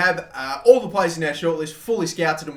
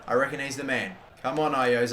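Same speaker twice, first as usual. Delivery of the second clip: ordinary talk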